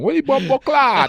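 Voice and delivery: high, loud mock Jamaican outburst; Jamaican accent. Radio guest jokes about switching languages, loud mock Jamaican. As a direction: holler it in a hammed-up Jamaican accent. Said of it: "Then I'll talk Jamaican".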